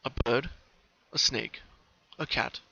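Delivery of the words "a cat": ordinary delivery, nothing marked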